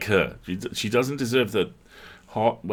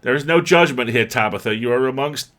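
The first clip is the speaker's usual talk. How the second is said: louder, higher, theatrical reassurance; grand declaration; 5 semitones higher, 7 dB louder than usual. Acted. The cat addresses Tabitha, loud and grandly theatrical. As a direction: proclaim it like a pompous host.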